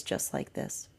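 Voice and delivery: soft whispered answer. Retold or imitated. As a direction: breathe it as a whisper. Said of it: "And the higher self says".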